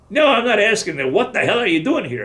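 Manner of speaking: retold colonel demanding; pressing colonel. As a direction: press him hard for an answer.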